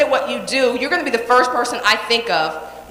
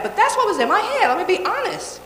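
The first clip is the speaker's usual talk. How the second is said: ordinary talk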